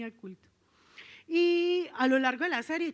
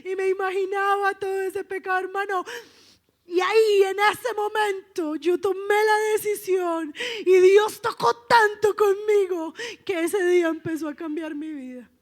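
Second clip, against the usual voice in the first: high, loud, emotional testimony; fervent preacher's outpouring, easing at the end; impassioned preaching; 7 semitones higher, 8 dB louder than usual. Real, not acted. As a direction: testify at full force, overcome.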